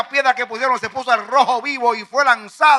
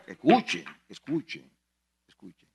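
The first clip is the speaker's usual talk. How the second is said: fading hush; 13 semitones lower, 13 dB quieter than usual. Acted. Preacher hushes congregation.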